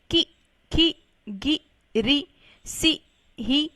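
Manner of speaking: की, खी, गी, री, सी, ही each a short separate syllable with a brief i; teacher demonstrating short i in की, खी, गी, री, सी, ही; short vowel sound. Shown as a demonstration. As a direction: say की, खी, गी, री, सी, ही one by one, keeping each i short. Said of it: "ध्वनि है, वो भी छोटी ही होगी".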